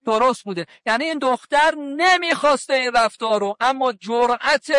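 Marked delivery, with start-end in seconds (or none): none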